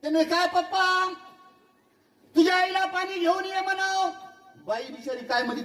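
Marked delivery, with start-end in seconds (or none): 0.0-4.1 high call, voice set apart; high calling father, far-off sound. Retold or imitated. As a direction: call the daughter to fetch water. Father calls to daughter.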